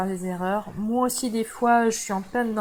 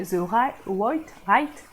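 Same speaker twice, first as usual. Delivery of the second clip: clumsy English accent; much slower than usual. Real, not acted. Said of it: "excusez-moi de mon accent anglais".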